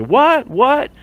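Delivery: high shocked "what"; imitating fake disbelief; mock surprise. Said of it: "he starts doing his".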